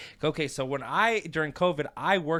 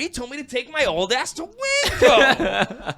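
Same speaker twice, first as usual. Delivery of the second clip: high, loud, whiny complaint to the crowd; high whining customer, slowed down; tattling customer; 11 semitones higher, 7 dB louder than usual. Retold or imitated. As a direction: play him calling out to the line, much higher. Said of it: "He's like".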